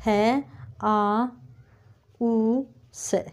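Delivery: है, आ, ऊ, से drawn out one sound at a time; syllable-by-syllable breakdown; slow sound-by-sound split. Shown as a demonstration. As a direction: break house into sounds very slowly. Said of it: "इसको अगर हम तोड़ेंगे".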